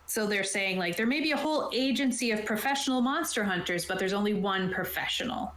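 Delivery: normal talking voice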